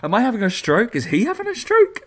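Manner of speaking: high, climbing baffled questions; mock incredulous. Podcaster retells doubting his own ears, mock incredulous. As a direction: climb into comic disbelief on each question.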